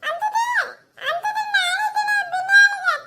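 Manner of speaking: high, wailing cries of burning pain; panicked crying. Acted. Boy in the pot cries out as the fire burns him, high panicked wail. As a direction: wail like a child scalded by fire.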